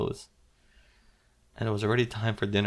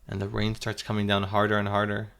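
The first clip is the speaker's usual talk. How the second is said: plain reading voice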